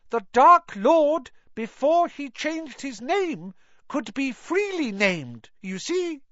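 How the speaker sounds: high squeaky elf voice; earnest little elf explaining; house elf voice. Acted. Narrator voices Dobby.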